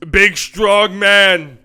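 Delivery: loud, drawn-out strongman boom; mock strongman. Commentator hyping up the big man Sabonis, mock strongman. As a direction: hammer each word, big and slow. Hammy chant about Sabonis, no accent attempted.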